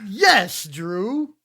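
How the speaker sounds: playful cheer for Drew; mock hype cheer. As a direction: hype him up like a fan. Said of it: "And I was like".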